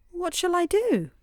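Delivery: high-pitched, worried question; acted girlish distress, high; helpless plea. A narrator's reading. Tearful girl stranded by rising water.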